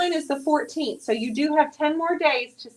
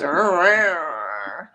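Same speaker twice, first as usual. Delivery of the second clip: imitated annoyed growl; 6 semitones lower than usual. Wife mimics Abby being annoyed with husband, imitated growl. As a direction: growl dropping low at the end. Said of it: "She just goes".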